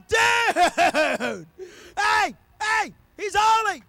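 high loud shout with a laugh; laughing, shout it to your neighbour; excited angel shout. Acted. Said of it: "They're like".

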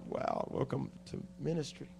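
very soft, low, dry reaction; wry aside. Retold or imitated. Visiting preacher recalling his thought on the graveyard town, wry and hushed. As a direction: let it drop nearly to nothing after a small laugh.

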